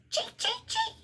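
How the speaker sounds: very high squeaky chattering; squirrel chatter. A narrator's reading. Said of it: "chattering back".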